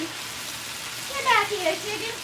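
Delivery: high, slow coaxing call; sing-song scolding at the food; coaxing the chicken. Pretend. Chasing a chicken piece.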